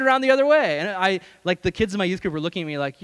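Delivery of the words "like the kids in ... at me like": normal talking voice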